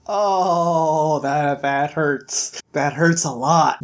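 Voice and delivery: drawn-out, slow groan of sympathy; pained reaction. Retold or imitated. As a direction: let the blow land, stretching the words. Reader's pained reaction to ending.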